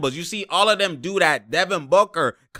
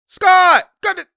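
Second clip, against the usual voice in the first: excited shout; 8 dB louder, slower than usual. Real, not acted. Excited cheer.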